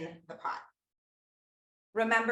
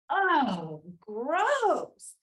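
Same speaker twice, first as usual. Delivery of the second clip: high, louder, stretched-out "gross"; disgusted kid; 7 semitones higher, 3 dB louder than usual. Retold or imitated. Little girl telling mom dinner is gross, high and whiny. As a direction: whine it like a five-year-old. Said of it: "And I said".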